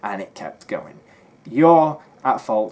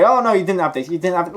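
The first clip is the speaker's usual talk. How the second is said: mocking retort; 6 semitones higher, 5 dB louder than usual. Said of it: "you're probably gonna argue and say".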